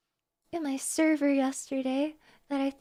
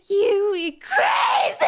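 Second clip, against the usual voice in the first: slow, higher and louder laughing scream; crazed shriek; 6 semitones higher, 8 dB louder, much slower than usual. Acted. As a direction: go over the edge with delight. Climax of the fan text.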